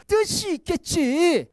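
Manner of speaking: slow, drawn-out 뜻이 있겠지; a steady, deliberate refrain. Acted. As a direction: lean into the refrain. Refrain repeated slowly.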